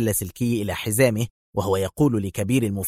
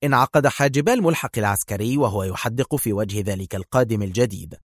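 plain reading voice

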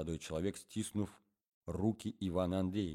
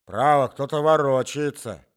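put-on higher, louder and slower old man; old man's voice; 7 semitones higher, 14 dB louder, slower than usual. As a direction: grumble like a deaf old man.

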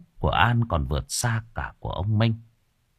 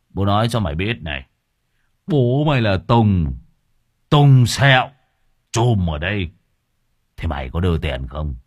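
loud swaggering gangster; menacing thug; 4 dB louder than usual. Acted. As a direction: boast your name as a threat. Thug boasting his name.

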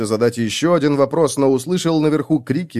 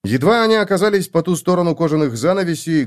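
usual speaking voice

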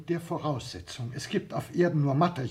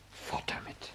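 whispered aside; 7 dB quieter, slower than usual. Acted. The secretary, alone, whispers as he sends the letter. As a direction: breathe it under your breath.

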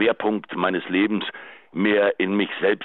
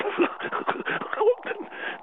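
short unvoiced laugh; 5 dB quieter than usual. Bitter laugh in pleading message.

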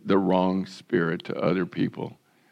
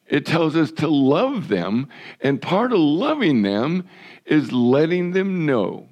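raised, pushing sermon voice; fervent preaching; 3 semitones higher than usual. Acted. Pastor urging his congregation to stand for righteousness, preaching hard. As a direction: press the point home to the flock.

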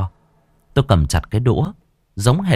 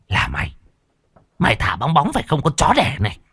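angry; 6 semitones higher than usual. Acted. Woman strangling narrator, fierce face.

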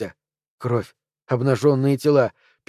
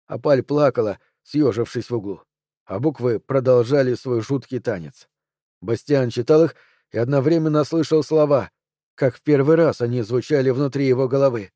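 ordinary delivery, nothing marked

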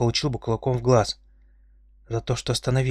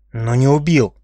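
indignant; 2 semitones higher, 8 dB louder, slower than usual. A narrator's reading. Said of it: "возмутился Динар".

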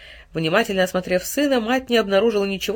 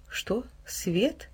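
much softer, puzzled question; surprised; 2 semitones higher, 10 dB quieter than usual. A narrator's reading. Mother puzzled by her son's words, softly surprised. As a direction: ask back, baffled. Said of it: "удивлённо переспросила мать".

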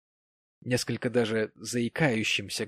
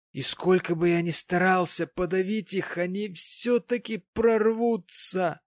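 maudlin plea, climbing higher as it goes; tearful, tongue-tied; 6 semitones higher, 3 dB louder than usual. A narrator's reading. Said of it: "обливаясь слезами и еле ворочая языком".

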